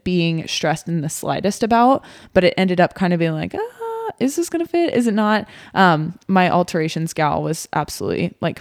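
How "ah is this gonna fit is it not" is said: a light squeal of doubt, then easing down; mock fretting. Retold worry over dress fitting.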